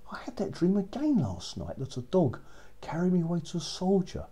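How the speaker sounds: high, hushed princess whisper; breathless young princess, voice lifted and hushed. Acted. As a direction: whisper the dream like a secret, pitched high. Princess tells dream to parents.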